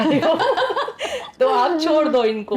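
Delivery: breaking into laughter while quoting her son; laughing. Retold or imitated. Quoting her son's scolding of the coach while laughing.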